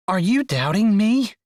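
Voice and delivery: playful indignant outburst, loud and high; mock outrage. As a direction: pretend to be offended. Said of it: "she asked in mock outrage".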